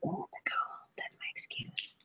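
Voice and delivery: whispered to herself like a guilty secret; sneaky whisper. Retold or imitated. Painter making excuses for using gold, whispered. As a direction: breathe it out in a playful whisper. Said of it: "so I'm gonna go".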